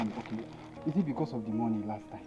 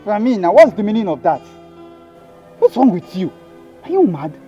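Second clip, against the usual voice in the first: loud, higher furious rant; angry denial; 5 semitones higher, 7 dB louder than usual. Acted. Man yelling at his pregnant girlfriend in fury. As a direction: rage at her, loud and accusing.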